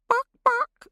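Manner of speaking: a mocking girl cackling like a hen; mock chicken. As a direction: play a silly chicken to taunt her. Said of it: "flapping her arms, elbows bent".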